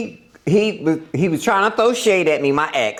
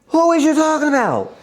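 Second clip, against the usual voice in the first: mock confused high-pitched comeback; a high, incredulous imitation of herself; acted disbelief; 9 semitones higher than usual. Retelling the ex's accusation, high and incredulous.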